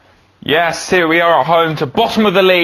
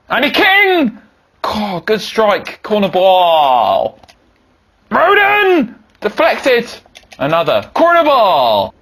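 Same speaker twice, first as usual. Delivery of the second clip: excited commentary; 5 semitones higher, much slower than usual. Acted. Commentating a dangerous free kick and corners, excited high calls. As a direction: call each chance like live TV. Tense attacking play commentary.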